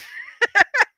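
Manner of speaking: giddy high-pitched cackle; burst of high laughter. Real, not acted. Streamer laughing at her own hyper state.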